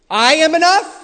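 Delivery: preacher scoffing at a rival's claim, rising and loud; incredulous echo. Real, not acted. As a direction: throw the phrase back in disbelief. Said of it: "Is that the point of this verse?".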